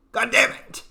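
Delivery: high-pitched exasperated curse; frustrated outburst. Real, not acted. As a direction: curse at your own failing memory. Frustrated at forgetting a name.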